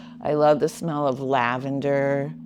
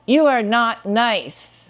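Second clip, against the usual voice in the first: louder and higher, slow: You are not nice; emphatic; 6 semitones higher, 6 dB louder, slower than usual. Shown as a demonstration. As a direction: punch it out, slow and loud.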